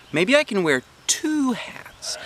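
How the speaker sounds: slow, high hushed whisper; scheming whisper. A narrator's reading. Girl plotting her hat plan in a whisper, hushed. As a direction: let the idea dawn in a whisper.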